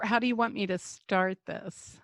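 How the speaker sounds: ordinary talk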